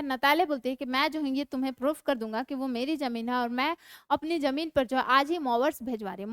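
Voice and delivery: usual speaking voice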